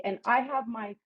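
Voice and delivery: lisping through teeth strips; talking with whitening strips in; slight lisp. Real, not acted. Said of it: "if you hear me lisping".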